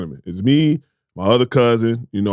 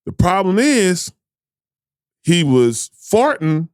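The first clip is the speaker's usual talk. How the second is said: slow dramatic build; 5 semitones higher, slower than usual. Retold or imitated.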